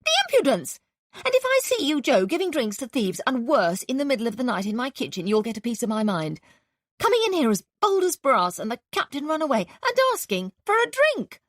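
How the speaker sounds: high, loud indignant housewife; scolding landlady. A narrator's reading. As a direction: berate him like an outraged landlady. Landlady scolding, voiced by narrator.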